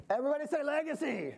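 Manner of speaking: rally them to call it back; call to crowd. Shown as a demonstration.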